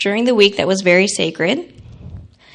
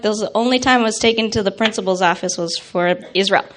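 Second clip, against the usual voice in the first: normal talking voice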